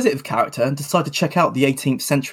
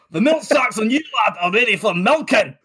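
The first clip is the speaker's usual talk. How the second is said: crude builder; 7 semitones higher than usual. Acted. [name] keeps heckling from the scaffolding, leering builder voice. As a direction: call it down like a jeering lout. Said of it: "[name] continues".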